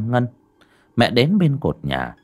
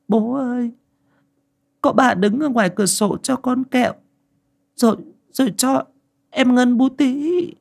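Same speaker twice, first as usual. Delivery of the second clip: put-on little girl. A narrator's reading. Little girl telling her father what she saw, high and halting. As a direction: stammer like a scared small child. Small child answers father.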